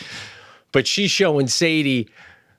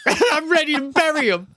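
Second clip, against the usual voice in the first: laughing. Real, not acted. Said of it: "I gotta tone it down".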